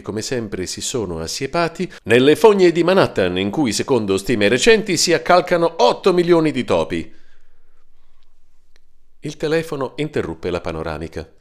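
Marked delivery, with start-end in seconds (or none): 0.0-7.1 loud newsreader. Acted. TV news clip while zapping.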